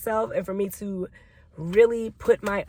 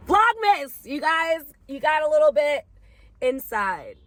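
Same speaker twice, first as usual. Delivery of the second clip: upbeat address; 7 semitones higher than usual. Real, not acted. Bright vlog address.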